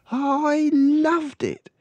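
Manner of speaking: put-on girl telling librarian she loved the book; delighted little girl. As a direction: gush it like an enchanted child.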